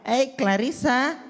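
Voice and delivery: high, gentle English-speaking rich dad; posh mall dad. Retold or imitated. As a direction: coo at the child like a rich Jakarta father. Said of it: "ngobrol sama anaknya pakai bahasa Inggris".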